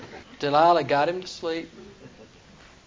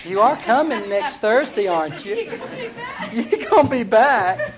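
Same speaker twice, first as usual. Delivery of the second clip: higher, louder, slow coaxing; a hint of coy Delilah asking higher and louder; 7 semitones higher, 4 dB louder than usual. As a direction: make sure he comes back. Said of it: "she said".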